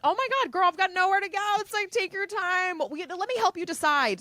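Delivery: pitched well up, bright and eager to help; high, overly sweet retold reassurance. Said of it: "But I said".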